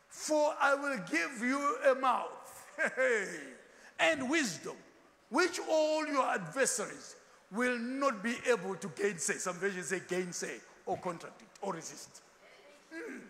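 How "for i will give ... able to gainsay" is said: slow, loud, drawn-out scripture reading; preaching scripture. Acted. Preacher reading Psalm aloud to his congregation, slow and loud. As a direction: proclaim the verse slowly to the congregation. Reads scripture in pulpit style.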